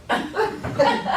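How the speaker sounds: a high breathy laugh acted out; imitated laugh. Trainer acting out a workshop attendee's strange laugh. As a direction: mimic the guy's odd laugh. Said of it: "he went like this, he went".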